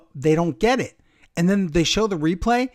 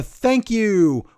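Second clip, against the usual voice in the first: lightly imitated catchphrase, slightly raised; 5 semitones higher, 3 dB louder than usual. Quoting a wrestler's catchphrase to a co-host, imitating lightly. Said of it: "used to say, 'Thank you.'".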